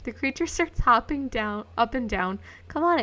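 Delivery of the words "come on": higher, impatient little grumble. Acted. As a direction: hurry them along, grumbling. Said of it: "it grumbles impatiently".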